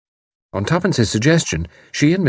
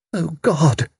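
high, despairing moan; 9 semitones higher than usual. A narrator's reading. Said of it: "moaned Missus Sprott".

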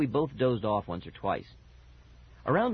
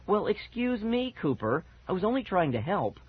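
huffy, high-pitched retort; snappish; 8 semitones higher than usual. A narrator's reading. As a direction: snap back, stretching the opening. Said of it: "Fergie snapped at me".

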